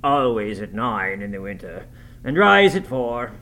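host's strained reply, swelling at the end; lightly voiced host holding back a groan; suppressing a groan. A narrator's reading. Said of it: "suppressing a groan".

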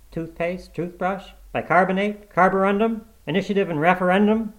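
high rising tone on each item; questioning list. A narrator's reading. Mitty trying to remember his errand, guessing questioningly.

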